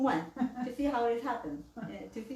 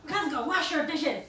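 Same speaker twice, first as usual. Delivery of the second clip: louder, higher command; bossy order; 4 semitones higher, 3 dB louder than usual. Shown as a demonstration. Telling Kazuko to wash dishes, bossy order. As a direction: snap it like an order. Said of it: "it's like a order".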